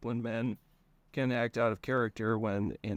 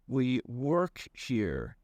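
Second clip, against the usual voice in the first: very slow flat reply; much slower than usual. Acted. Conrad answers flatly.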